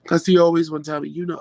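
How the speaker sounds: ordinary talk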